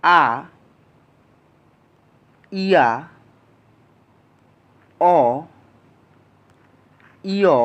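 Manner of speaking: each vowel from আ to উ held evenly, long pauses between; vowel recitation. Shown as a demonstration. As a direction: recite আ, ইয়া, অ, ইয় through উ slowly, one isolated sound each. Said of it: "আমরা বর্ণগুলো আবার উচ্চারণ করি".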